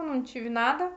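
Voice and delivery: usual speaking voice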